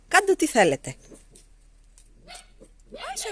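high sweet cooing to timid little animals; coaxing baby talk. Acted. Coaxing the shy little ones out of hiding, high cooing baby talk.

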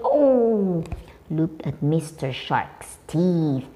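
ordinary talk, nothing marked

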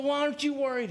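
high, exasperated imitation; mock-frantic pleading question; aggravated disbelief. Panicking castaway demanding answers from his friend, high and aggravated. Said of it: "he gets so aggravated".

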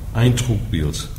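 ordinary talk

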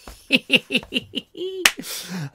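high-pitched giggle; high laughter. Real, not acted. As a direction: break up in a high titter. Reader breaks into laughter.

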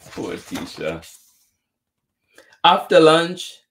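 ordinary delivery

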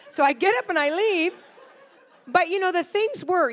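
amused at the memory; laughing. Real, not acted. Laughing at her own story.

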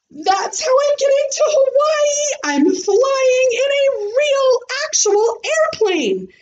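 high, fast and proud; girl boasting to the class, excited; bragging. Acted. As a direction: show off the big news. Bragging about plane trip.

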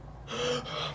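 sharp high startled gasp; sudden high intake of breath; fan's gasp. Retold or imitated. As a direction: gasp like a starstruck fan. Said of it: "막 이러면서 난리 났지".

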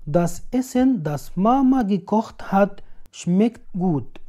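normal talking voice

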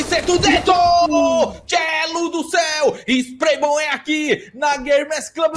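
loud, excited, drawn-out name calls; full-force play-by-play. Real, not acted. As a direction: keep shouting the action at full pitch. Narra abates no auge. (narração gritada e empolgada)

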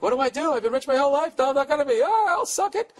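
high-pitched mock panic; hammed-up high whine of a freak-out. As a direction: imitate a spoiled rich girl panicking, pitch way up. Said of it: "She's freaking out".

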